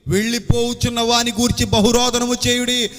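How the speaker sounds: ringing sermon climax, final words stretched slowly; full-force preaching. Real, not acted. Preacher urging congregation to weep for the departing, full-force preaching. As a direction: proclaim the verse in long, drawn-out phrases.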